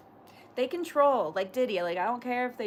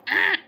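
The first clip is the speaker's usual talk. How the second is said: high, loud sudden "ah" outburst; imitated crazy-girl yelp; 6 semitones higher, 9 dB louder than usual. Streamer imitating a crazy girl from another stream, loud yelp. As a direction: mimic a sudden involuntary yelp. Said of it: "she's always like".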